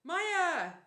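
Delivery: high, loud command yell. A narrator's reading. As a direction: shout the magic word.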